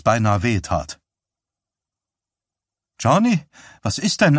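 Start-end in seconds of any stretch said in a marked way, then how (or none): 3.0-4.4 higher, questioning alarm; anxious check on the patient; concerned. A narrator's reading. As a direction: ask with sudden worry. Trainer alarmed at patient's odd look.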